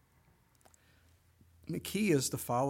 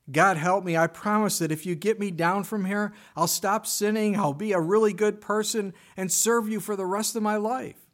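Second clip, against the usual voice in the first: loud, high begging promises; desperate pleading; 6 semitones higher, 7 dB louder than usual. Retold or imitated. Jack begging God for rescue, loud and high. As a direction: bargain for your life.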